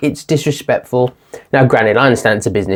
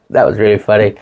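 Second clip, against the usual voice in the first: ordinary delivery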